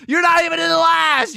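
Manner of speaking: shouting in disbelief; exasperated shout. Real, not acted. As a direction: yell it at the screen in disbelief. Shouting at the game.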